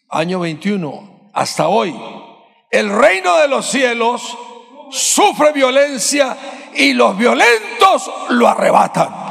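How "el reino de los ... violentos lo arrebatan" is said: loud, high, slow preaching shout; shouted proclamation. Acted. Preacher shouting the verse at the church, loud and high. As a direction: cry the verse out with force.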